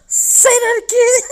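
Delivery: laughing quote. Retold or imitated. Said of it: "she talking about some".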